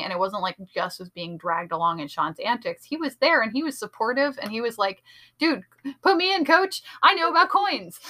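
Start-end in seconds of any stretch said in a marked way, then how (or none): 5.4-8.0 high, loud mock-eager plea; eager volunteering. Retelling a supportive sidekick volunteering to his partner, loud and eager. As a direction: imitate someone pumped up and begging to join in.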